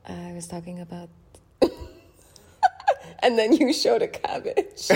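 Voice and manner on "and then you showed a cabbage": high, loud, laughing through the words. Real, not acted. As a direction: crack up while teasing. Teasing memory breaks into laughter.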